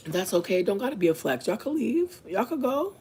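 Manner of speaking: high, sing-song brush-off; mock dismissive. Mocking the roommate's dismissal.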